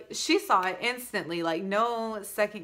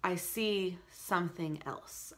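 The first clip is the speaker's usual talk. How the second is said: ordinary delivery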